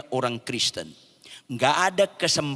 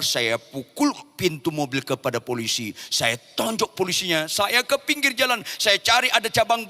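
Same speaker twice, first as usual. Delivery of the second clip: ordinary talk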